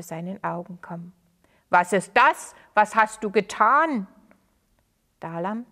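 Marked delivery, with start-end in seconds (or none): 1.7-4.1 loud, stunned questions; shocked outcry. Acted. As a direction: disbelief bursting out. Stranger sees the broken stone.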